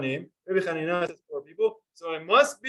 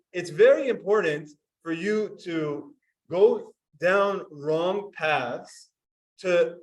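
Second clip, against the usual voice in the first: usual speaking voice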